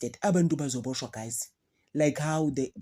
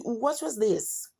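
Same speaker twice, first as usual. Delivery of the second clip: higher, drawn-out puzzled question; mock a kid asking, high and slow; imitating future kids; 8 semitones higher, much slower than usual. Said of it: "this is how they're gonna be speaking".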